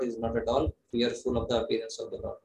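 usual speaking voice

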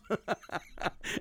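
short unvoiced chuckle; laughing. Real, not acted. Speaker amused by getting carried away, laughing. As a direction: laugh at your own outburst.

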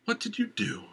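worried question pitched well up; a touch of alarm as she smells smoke; lady asks, a little worried. A narrator's reading. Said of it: "sounding a little worried".

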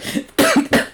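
sharp choking cough. Real, not acted. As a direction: cough from beer down the wrong pipe. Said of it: "I'm choking on my beer".

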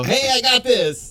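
high, drawn-out, hammed-up shout; cocky call-out. Host voicing the passenger who thinks he'll fly, cocky call-out. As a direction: call it out with daft confidence. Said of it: "next to the plane and go".

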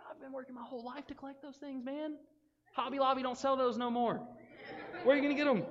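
high, pleading, half-laughing whine; whiny demon collector. Acted. Demon-possessed man begs Jesus to keep his spirits, high comic whine. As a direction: beg like a collector losing his hobby. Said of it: "so he was like".

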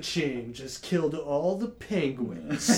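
slow trailer narrator voice; dramatic in a world delivery; movie trailer voice. Pretend. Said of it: "the in a world guy".

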